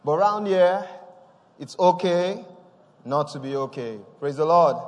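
loud, rising preacher's call; loud revival preaching; fervent preaching. Acted. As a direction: proclaim it to the whole church. Preaching at full force.

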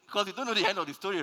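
high, loud, fast emphasis; excited emphasis. Real, not acted. Preacher pressing the point on the congregation, excited. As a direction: drive the point home with a rush.

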